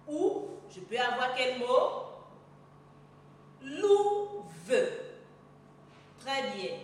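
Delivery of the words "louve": "Louve" split into a long, loud "lou" and a separate "ve"; teacher sounding out "Louve" in two beats; syllable by syllable. Shown as a demonstration. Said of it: "on le lit syllabe par syllabe".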